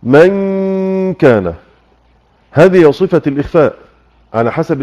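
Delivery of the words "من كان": من كان stretched very slow, the نون hidden in a long nasal hum before the كاف; ikhfa demo. Shown as a demonstration. Teacher demonstrating hiding the نون in من كان. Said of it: "الآن لما أقول".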